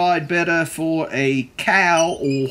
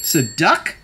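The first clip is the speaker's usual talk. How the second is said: usual speaking voice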